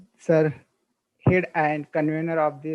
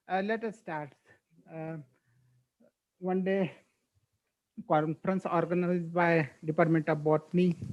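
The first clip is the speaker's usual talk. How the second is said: barely audible; 7 dB quieter than usual. Real, not acted. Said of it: "low voice is there?".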